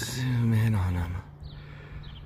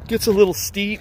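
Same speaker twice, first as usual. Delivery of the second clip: breathless sigh, higher and fast; out of breath; 9 semitones higher than usual. Real, not acted. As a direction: pant it out while climbing. Climbing steep part, panting.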